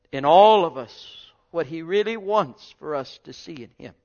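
ordinary talk, nothing marked

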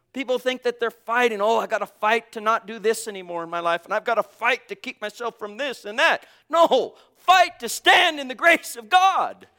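loud, high, fervent preaching; full-force preaching. Acted. Preacher stirring the congregation about grace, loud and fervent. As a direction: preach it out with fire.

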